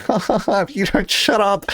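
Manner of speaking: high fake laugh running into a threat; mock psychopathic laugh. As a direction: imitate a roommate laughing menacingly. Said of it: "if they have a psychopathic laugh".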